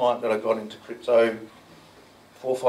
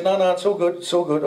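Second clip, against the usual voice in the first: higher-pitched imitation of a client waving off worry; retold "don't worry", pitched up; hurried reassurance; 7 semitones higher than usual. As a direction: brush the worry aside in a higher voice.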